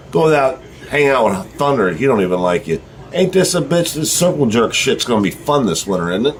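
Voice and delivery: ordinary talk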